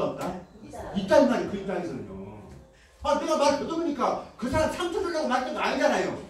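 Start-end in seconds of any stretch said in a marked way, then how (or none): 3.0-6.2 heated protest. Acted. Speaker heatedly defends self.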